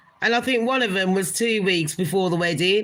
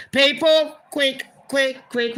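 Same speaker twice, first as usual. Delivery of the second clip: excited summons, pitched up; urgent excitement; 5 semitones higher, slower than usual. Real, not acted. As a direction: hurry everyone over. Urgently calling viewers to look.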